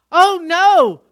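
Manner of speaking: loud, high cry of alarm; panicked dad. Retold or imitated. Father realizing his affair may be exposed, loud and panicked. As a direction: blurt it out in sudden horror. Father learns dog knows secret.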